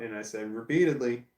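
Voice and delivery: normal talking voice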